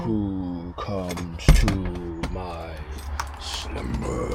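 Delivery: deep, very slow vampire growl. Retold or imitated. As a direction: drag each word out like an old vampire. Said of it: "That's how they say it".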